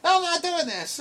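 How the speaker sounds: very high mock child voice; imitating a defiant kid. Friend replaying the kid's second refusal, high mock whine. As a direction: squeal the refusal like a stubborn kid. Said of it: "and you're like".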